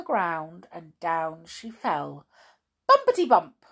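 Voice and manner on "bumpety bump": bouncy bumpety. A narrator's reading. Comic fall sound in story.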